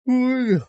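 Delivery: loud rising yawn; a big yawn mid-stream. Real, not acted. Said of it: "Sorry for yawning".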